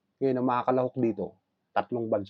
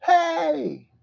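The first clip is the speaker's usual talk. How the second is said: high ringing yell across the mountains; shout; 8 dB louder than usual. Acted. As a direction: belt it out to make an echo. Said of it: "Sumigaw din ng".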